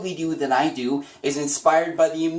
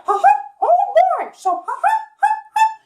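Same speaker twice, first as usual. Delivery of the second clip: much higher, loud squeaky laugh; high falsetto chuckle; Mickey Mouse laugh; 7 dB louder, faster than usual. Shown as a demonstration. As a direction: giggle like the cartoon mouse. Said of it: "Mickey Mouse".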